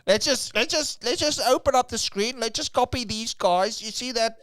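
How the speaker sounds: high, loud mocking impression voice; terrible mocking voice. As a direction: ham up a silly voice for laughs. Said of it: "Your voice is terrible".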